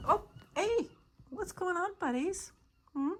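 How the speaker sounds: high cooing pet greeting. Real, not acted. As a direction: coo hello to the kittens. Greeting the cats.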